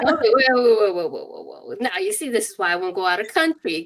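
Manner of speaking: mock alarm, loud burst of whoas tailing off; alarmed disbelief. Patient balking at a free phone consult, alarmed disbelief.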